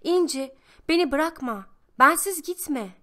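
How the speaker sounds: put-on child in a dream, screaming for İnci not to leave; desperate shout. A narrator's reading. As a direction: yell from inside the pot. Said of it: "Öyle bağırarak uyanmışım yine".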